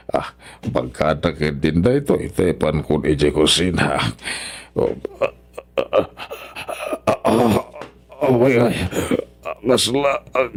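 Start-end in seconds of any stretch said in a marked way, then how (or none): 4.7-10.5 broken gasps climbing higher and higher; gasping in pain. Acted. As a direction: struggle to get words out as the body gives way. Father collapsing, later called stroke.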